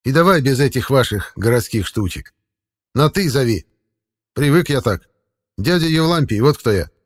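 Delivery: lightly voiced old forest man; a hint of a folksy old man; creepy caretaker.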